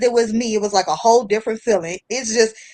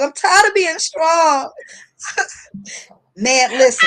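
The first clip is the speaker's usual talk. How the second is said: tearful outcry; 4 semitones higher than usual. Real, not acted. Telling co-host she's tired of being strong, tearful outcry. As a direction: let it burst out tearfully.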